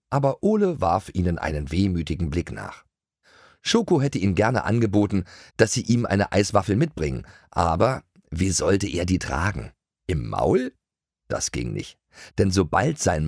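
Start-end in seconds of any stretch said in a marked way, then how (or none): none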